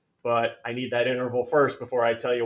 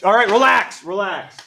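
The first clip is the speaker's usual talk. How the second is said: loud and high, worked up; calm yourself down, loudly; fired up; 9 semitones higher, 11 dB louder than usual. Real, not acted. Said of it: "I was getting all fired up".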